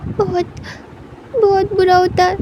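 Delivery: crying. Acted. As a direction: choke it out through tears. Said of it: "wing के tears को साफ करता".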